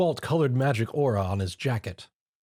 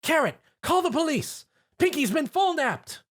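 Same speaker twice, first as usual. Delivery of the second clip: very high frantic cry; mother finding her girl gone; panicked shout. Acted. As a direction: shriek for help. Mrs Cake panicking.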